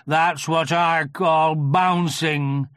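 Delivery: slow, loud, aggrieved donkey; Eeyore. A narrator's reading. Eeyore explaining his fall in the river, slow and aggrieved. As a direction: lay out the grievance ponderously. Narrator voices Eeyore complaining.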